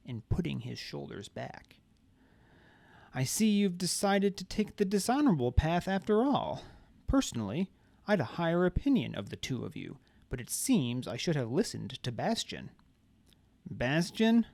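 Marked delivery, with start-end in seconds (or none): 3.1-12.7 dignified. A narrator's reading. Deliritus speaks with dignity. 13.7-14.5 questioning. A narrator's reading. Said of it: "Marla asked".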